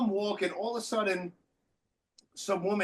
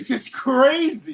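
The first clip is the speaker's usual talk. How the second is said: stunned; 7 semitones higher, 9 dB louder, slower than usual. Retold or imitated. Echoing the stunned reaction.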